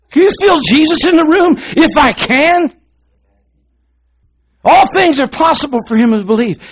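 loud, high revival preaching, near shouting; preaching at full force. Acted. Preacher rousing his church to faith, shouting it out. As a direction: drive it home to the congregation.

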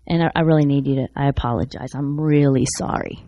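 soft, low, humble confession; sincere apology. Retold or imitated. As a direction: gently ask their forgiveness, voice dropped. Retells his humble apology to boys.

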